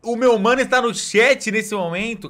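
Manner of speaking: high, loud, slow excited yell; excited shout. Real, not acted. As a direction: shout it out in delight. Friend appears in stream chat.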